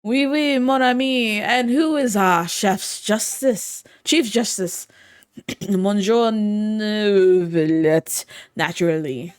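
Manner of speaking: loud hammed-up French accent. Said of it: "If only I could do a French accent".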